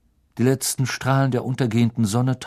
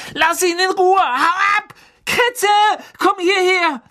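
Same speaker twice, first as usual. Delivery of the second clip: much higher, loud yelling on the run; frantic shout; 7 dB louder than usual. Acted. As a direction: scream at the cat while chasing it. Ron chasing the cat, shouting.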